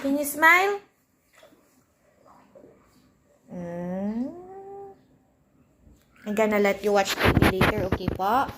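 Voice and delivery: soft, patient coaxing for a little one; gentle mom voice. Real, not acted. Mother coaxing her daughter to go play instead.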